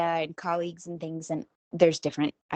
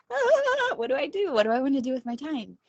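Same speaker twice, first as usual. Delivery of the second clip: high-pitched mock panicked outcry; hammed-up kid left alone, squealing high; Kevin from Home Alone; 9 semitones higher than usual. Woman playing at being suddenly home alone, mock panicked delight. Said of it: "Home Alone moment where I'm like".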